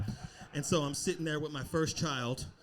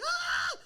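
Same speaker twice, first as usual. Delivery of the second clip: very high, loud short scream; retold scream; 5 dB louder than usual. Comic imitating wife in labor, high scream. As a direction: yelp out a sudden high shriek. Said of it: "It wasn't really screams".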